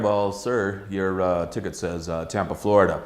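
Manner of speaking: low and soft, unimpressed official; a border guard calmly catching a lie; dry border guard. Retold or imitated. Border guard calmly catching the traveller's lie, low and soft. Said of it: "They're going".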